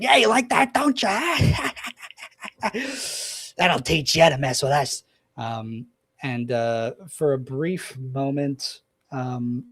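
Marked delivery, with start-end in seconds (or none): 0.0-1.4 high, loud taunting gnome through a megaphone; a cackling little gnome mocking his hurt enemy; goading villain. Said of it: "goading David Rain, um, by saying". 1.4-3.5 high gleeful villain cackle; a gnome cackling over a wounded foe; evil cackle. Acted. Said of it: "he's cackling away in it". 3.6-5.0 taunting gnome, a bit louder; sneering gnome taunt over a megaphone; goading villain. Acted. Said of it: "goading David Rain, um, by saying".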